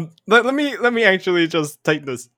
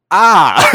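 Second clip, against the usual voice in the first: priest's yelp; 7 dB louder than usual. Acted. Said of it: "and he says".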